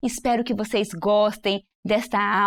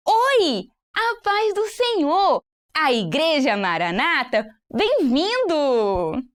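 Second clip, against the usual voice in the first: high, slow joyful greeting; animated welcome; 4 semitones higher, much slower than usual. Shown as a demonstration. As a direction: welcome them gladly, drawn out. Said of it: "Volta lá pra poder ver". (boas-vindas alegres e arrastadas)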